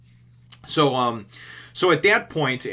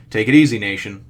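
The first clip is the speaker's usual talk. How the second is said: loud greeting; 12 dB louder than usual. Real, not acted. Show opener called to viewers.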